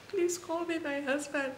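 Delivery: high, slow desperate plea; pleading in pain. Retold or imitated. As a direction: beg for the husband like the pain is unbearable. Begging for husband during painful contractions.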